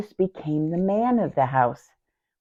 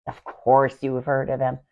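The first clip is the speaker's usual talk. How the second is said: normal talking voice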